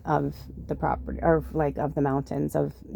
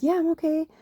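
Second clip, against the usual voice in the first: reassuring; 12 semitones higher than usual. Retold or imitated. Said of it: "And I said".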